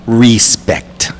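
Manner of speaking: whispered Jamaican accent try. Shown as a demonstration. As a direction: breathe Respect with a Jamaican accent. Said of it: "I can't do it".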